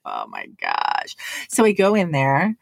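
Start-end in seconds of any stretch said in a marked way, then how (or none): 0.0-1.2 very low, dragged oh my gosh; deep mortified mutter; low groan. Retold or imitated. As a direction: groan it out in embarrassment. Embarrassed about the line.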